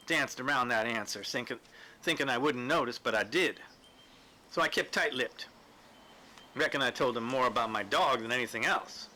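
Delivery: ordinary delivery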